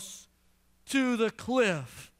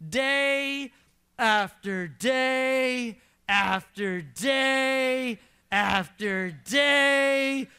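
very slow, stretched repetition; drawn out; much slower than usual. Shown as a demonstration. Preacher stretching out the nagging wife's pressure, slow and drawn. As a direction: drag each day out to feel the nagging. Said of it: "drawn out for emphasis".

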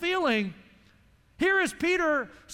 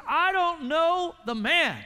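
louder, slow, higher-pitched "I don't know the man"; angry denial; 5 semitones higher, 4 dB louder, slower than usual. Retold or imitated. Peter cursing, denying Jesus, loud and angry. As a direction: spit it out as a furious denial. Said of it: "Peter began to drop curse words".

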